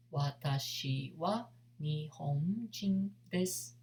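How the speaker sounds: slow, syllable by syllable; slow demo. Shown as a demonstration. Teacher to students learning Japanese, slow clear demo. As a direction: spell it out slowly for beginners.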